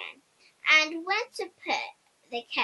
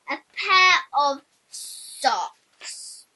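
slow reveal; 6 dB louder, much slower than usual. A narrator's reading. Reveal of the silly present.

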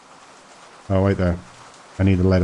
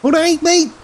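[name]. Acted. Player greets a customer who looks like a singer, high mock impression. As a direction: put on a high, exaggerated rock-star hello. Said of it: "This guy looks like [name]".

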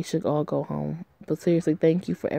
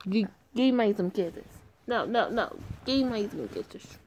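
high, soft coaxing voice; voiced kissy character; 5 semitones higher, 6 dB quieter than usual. Game player voicing a character asking for kisses, soft and high. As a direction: coax him for kisses.

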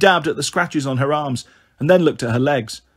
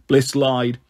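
ordinary delivery

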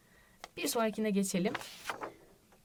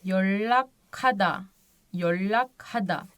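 yollakada yavaş, çift l ile; slow model; much slower than usual. Shown as a demonstration. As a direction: hece hece yollakada. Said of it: "nasıl okunuyor". (yavaş örnek okuma)

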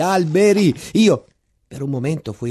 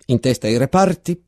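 captain's repeated shout; 6 semitones higher than usual. Acted. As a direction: bark it across the trench. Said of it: "Poi riprese".

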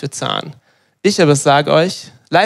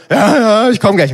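irritated, higher and louder brush-off; annoyed; 6 semitones higher, 5 dB louder than usual. Retold or imitated. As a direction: imitate the wife's impatient "in a minute". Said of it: "so hat sich's für mich angehört".

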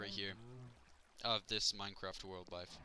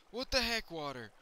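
exasperated; 8 semitones higher, 5 dB louder than usual. Real, not acted. Water misbehaves.

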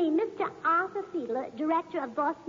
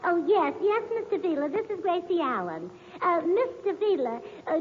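usual speaking voice

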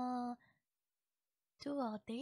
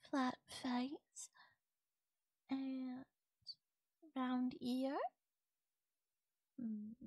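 softer close-up ASMR murmur; trying ASMR voice; 3 dB quieter than usual. Pretend. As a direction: lean in and murmur like an ASMR video. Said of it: "let me try".

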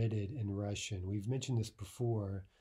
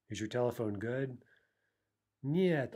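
ordinary talk

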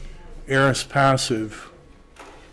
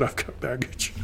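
mock support-group confession, softer; 9 dB quieter than usual. Teacher mimicking a support group member, mock confession. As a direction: introduce yourself to the group, sheepish and hushed. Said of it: "Hi, my name's Judd".

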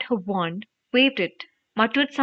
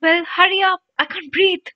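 high, urgent strained cry; gasped plea; 9 semitones higher, 4 dB louder than usual. A narrator's reading. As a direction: beg for help while being squeezed. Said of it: "Harry gasped".

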